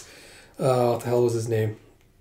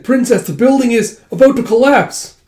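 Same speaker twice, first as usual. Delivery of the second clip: high, loud urgent cry; alarmed warning; 9 semitones higher, 6 dB louder than usual. Acted. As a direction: warn the princess of danger. Acted warning of collapse.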